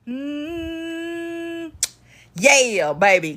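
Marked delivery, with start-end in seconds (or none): none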